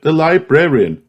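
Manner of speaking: usual speaking voice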